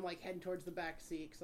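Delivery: usual speaking voice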